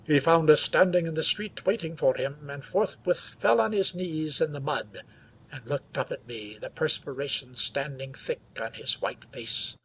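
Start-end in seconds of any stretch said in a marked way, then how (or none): none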